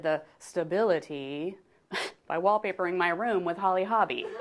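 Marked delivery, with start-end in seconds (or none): none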